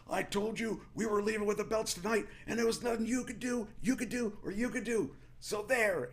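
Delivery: high-pitched, defiant gloating; taunting mic promo. Retold or imitated. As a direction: gloat at the crowd, pitched well up. Retold taunt to crowd on mic.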